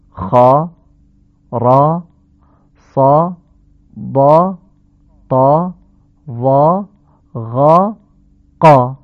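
each syllable called out loud and full; خا، را، صا sounded heavy with an open a; heavy letters with fatha. Shown as a demonstration. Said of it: "این هشت حرف عبارت بودن از". (حروف مفخمه با فتحهٔ کشیده و بلند)

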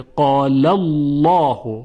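slow "قال الله", heavy dark l in "الله"; rounded, full lam in "قال الله"; thick lam. Shown as a demonstration. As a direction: recite "قال الله" as "qaalallawhu", drawn out. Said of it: "تفخیم بشه".

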